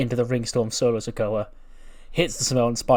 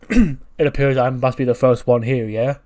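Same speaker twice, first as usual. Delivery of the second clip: louder, cocky wrestler promo voice; L A Knight impression; 8 dB louder than usual. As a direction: swagger it like a megastar. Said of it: "he takes his sunglasses off and he goes".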